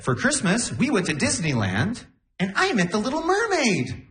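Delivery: high-pitched show-and-tell brag; rich little girl. Retold or imitated. Acting out rich girl at school.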